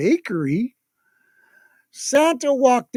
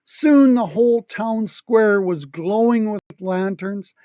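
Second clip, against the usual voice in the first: ordinary delivery, nothing marked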